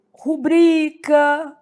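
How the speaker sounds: slow rubrica, ru-BRI-ca; stress on BRI. Shown as a demonstration. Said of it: "a sílaba tônica".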